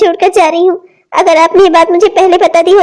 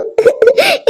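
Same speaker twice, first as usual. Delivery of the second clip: sob; 6 semitones higher, 3 dB quieter than usual. Acted. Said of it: "मैं रो रही हूँ".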